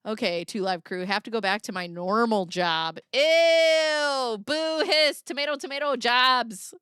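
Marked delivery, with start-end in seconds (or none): 3.1-6.7 loud, high playful booing; mock heckling. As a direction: jeer like a heckler pelting tomatoes. Mock heckling of normal jobs.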